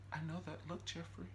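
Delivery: quiet, high put-on threat; menacing Veronica. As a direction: hush it like a creepy villainess. Veronica's menacing line retold.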